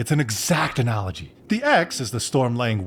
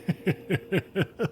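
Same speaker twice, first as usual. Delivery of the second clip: awkward laugh; 7 semitones higher, 6 dB quieter than usual. Acted.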